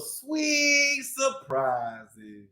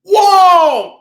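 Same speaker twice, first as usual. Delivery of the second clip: loud exclamation of surprise; loud surprised whoa; 6 semitones higher, 12 dB louder than usual. Acted. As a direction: throw out a big whoa. Reveal in story.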